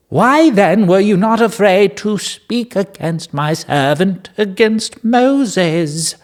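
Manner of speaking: high, slow, solemn rebuke read aloud; dramatic scripture reading. Acted. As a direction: intone the rebuke grandly, dragging the last words. Reads God's rebuke dramatically.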